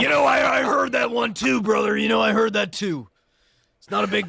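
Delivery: loud, shouty wrestler promo; hammed-up wrestler brother voice; mock wrestler. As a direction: talk like a pro wrestler cutting a promo. Answering in wrestler persona.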